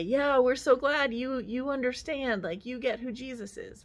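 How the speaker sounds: mock yell of approval for the good criminal; cheering. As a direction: cheer him on like a fan in the stands. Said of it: "yell and cheer".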